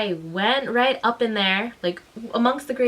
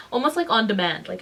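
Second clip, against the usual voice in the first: normal talking voice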